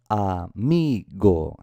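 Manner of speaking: amigo drawn out very slowly, syllable by syllable. Shown as a demonstration. Teacher showing pupils where the accent falls, amigo slowly sounded out. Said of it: "cuando las pronunciamos en voz alta".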